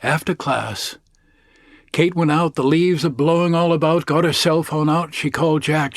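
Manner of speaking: ordinary delivery, nothing marked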